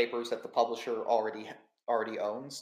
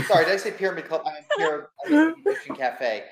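laughing; 8 semitones higher, 11 dB louder than usual. Real, not acted. Laughing at own slip-up.

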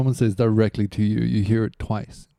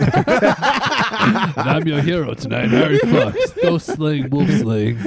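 heroic announcer; 6 semitones higher, 4 dB louder, much slower than usual. Acted. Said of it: "announces himself as hero".

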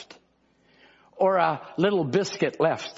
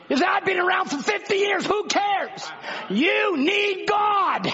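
a preacher pleading and shouting the call; preaching at full force; 8 semitones higher than usual. Acted. Preacher urging the proud congregation, high, shouting sermon.

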